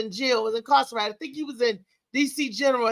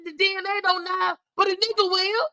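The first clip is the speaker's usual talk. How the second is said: higher-pitched defiant retort, a hint of mockery; pitched-up sarcastic clapback; sassy comeback; 6 semitones higher than usual. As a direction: snap back at the mother's line with attitude. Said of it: "I said".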